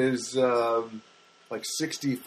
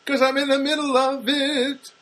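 high, bright punchline to the folks; playful punchline; 13 semitones higher, 4 dB louder than usual. Real, not acted. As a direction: toss the joke out high and playful.

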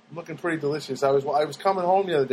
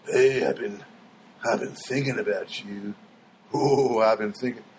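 creepy come-on; 6 semitones lower than usual. Pretend. Mock creep he did not say to the deli guy, low and leering. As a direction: drop low and leer it out. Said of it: "I didn't say, like".

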